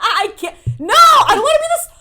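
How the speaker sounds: high, loud laughing outcry; loud, half-laughing complaint; laughing protest. Real, not acted. Laughing protest, much louder.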